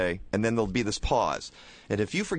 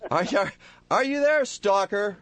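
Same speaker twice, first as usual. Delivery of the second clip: calling out; 10 semitones higher, 3 dB louder than usual. Acted. Calling the caller on line.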